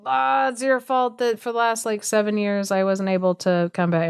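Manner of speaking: usual speaking voice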